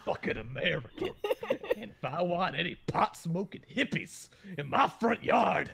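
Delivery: put-on cranky yard-owner voice. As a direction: rant like a hippie-hating patriot in jest. Mock anti-marijuana patriot rant.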